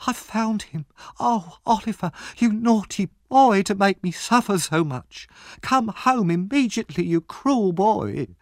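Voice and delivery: high, drawn-out tearful wailing; crying loudly. A narrator's reading. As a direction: wail reproachfully as a distraught sister. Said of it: "started to cry loudly".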